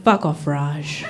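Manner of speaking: low, slow deadpan comeback; low and slow, shut him down; deadpan retort. Retold or imitated. Telling off the Indian classmate, low deadpan retort. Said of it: "And I was like".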